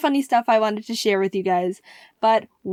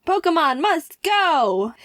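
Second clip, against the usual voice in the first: slow, higher "Pokemon must go"; proud slogan cheer; 6 semitones higher, slower than usual. Real, not acted. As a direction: proclaim it like a triumphant slogan. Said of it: "He also used his Pokemon one too".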